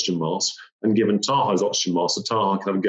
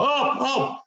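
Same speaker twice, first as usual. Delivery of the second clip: alarmed Sherpa. Retold or imitated. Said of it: "I'm like".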